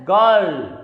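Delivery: put-on "girl" with the R left out; silent R. Shown as a demonstration. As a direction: say "girl" as vowel then L, no R. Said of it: "It will be pronounced as". ("girl" में R silent)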